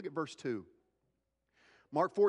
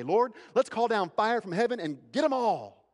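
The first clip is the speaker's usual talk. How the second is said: eager shout; 6 semitones higher, 6 dB louder than usual. Retold or imitated. Disciples eager to punish town.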